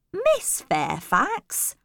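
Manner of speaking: high, slow questioning echo; puzzled. A narrator's reading. Housekeeper echoes the governess's question, puzzled. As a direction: repeat the name in puzzlement, rising.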